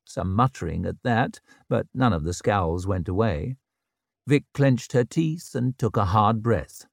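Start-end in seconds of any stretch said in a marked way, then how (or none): none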